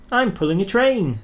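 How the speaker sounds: high, proud declaration; proudly. Acted. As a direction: boast to the signalman. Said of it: "said Thomas proudly".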